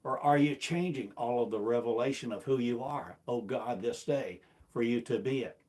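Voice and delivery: normal talking voice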